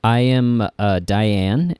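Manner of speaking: normal talking voice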